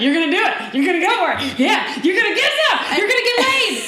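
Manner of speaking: teasing build. Retold or imitated. Voicing Joe teasing her excitedly.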